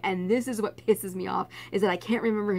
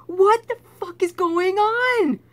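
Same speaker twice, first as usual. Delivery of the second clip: higher, louder, slow retold fury; frustrated outburst; 11 semitones higher, 8 dB louder, slower than usual. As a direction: blurt it out in teenage rage. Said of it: "I was getting angrier and angrier".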